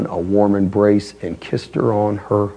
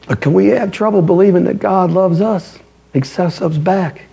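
usual speaking voice

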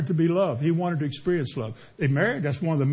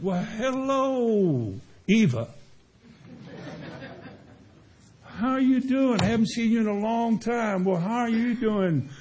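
flirty greeting; 5 semitones higher, slower than usual.

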